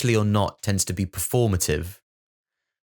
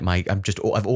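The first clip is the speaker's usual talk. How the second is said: ordinary talk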